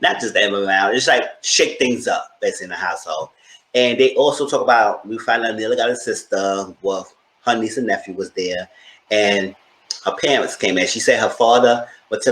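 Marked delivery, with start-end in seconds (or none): none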